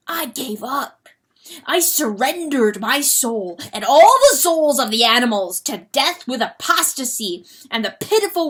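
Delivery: loud, high desperate confession; frenzied confession. Acted. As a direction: pour out the guilt in a frenzy.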